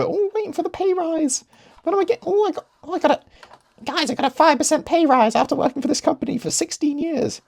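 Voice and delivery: very high-pitched, squeaky mocking voice; mocking employee. Worker boasting of a measly pay rise, mocking squeak. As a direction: put on a silly high voice to ridicule them.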